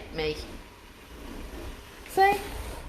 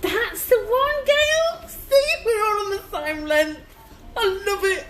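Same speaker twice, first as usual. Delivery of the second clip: excited; 11 semitones higher, 3 dB louder than usual. Real, not acted. Excited chat to viewers.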